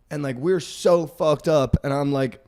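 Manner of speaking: normal talking voice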